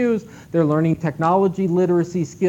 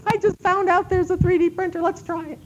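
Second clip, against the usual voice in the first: a lightly voiced excited leader, pitched much higher; mock crazy leader; 12 semitones higher than usual. Leader announcing a new 3D printer, mock excited voice. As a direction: imitate an eager boss with a new idea. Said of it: "the crazy eye leader, that goes back and says".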